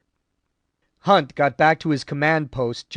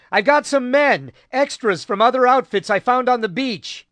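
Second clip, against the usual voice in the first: raised, loud shouted report, pitched higher; 8 semitones higher, 4 dB louder than usual. A narrator's reading. Haggerty yells news to the captain, loud shouted report. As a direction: call it out over the battle noise. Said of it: "he yelled".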